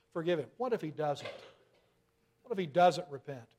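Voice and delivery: normal talking voice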